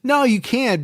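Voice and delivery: ordinary delivery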